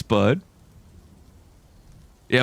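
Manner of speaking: normal talking voice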